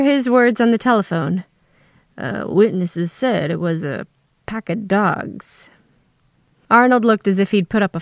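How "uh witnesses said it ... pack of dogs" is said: slow, hesitant man on the phone. Retold or imitated. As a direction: hesitate like a wary detective. Oz's voice remembered on phone.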